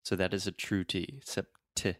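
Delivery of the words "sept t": sept-, t with a puff of air on the t; aspirated T. Shown as a demonstration. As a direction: pop the t with a breath of air. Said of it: "I'm really aspirating".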